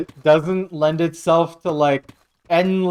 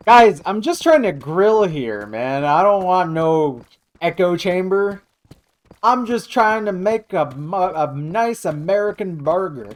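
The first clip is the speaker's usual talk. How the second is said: mock American grill guy; 4 semitones higher than usual. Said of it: "I don't know what accent I'm doing".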